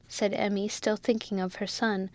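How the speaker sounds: ordinary delivery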